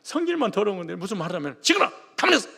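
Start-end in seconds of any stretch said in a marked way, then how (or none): 1.6-2.5 barked at the wife, high and loud; hammed-up husband shutting his wife up; a Jeolla husband silencing his wife, mock yell; mock bossy husband.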